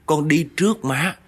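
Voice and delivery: high, pleading voice of a dead mother begging forgiveness; lightly voiced weeping daughter, pitch lifted high; a ghost's high, pleading farewell; tearful plea. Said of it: "Cô Út kêu á".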